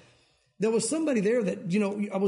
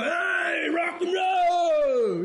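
put-on high, long drawn-out drunk holler; hammed-up rowdy yell, vowels stretched way out; drunk heckler; 9 semitones higher, much slower than usual. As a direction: imitate a drunk whooping at the band. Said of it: "making fun of somebody being drunk".

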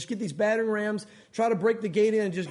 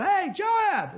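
high-pitched hailing yell; 9 semitones higher than usual. Acted. Somebody on the city wall hailing Joab, yelling out. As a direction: hail someone far below. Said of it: "somebody yells out".

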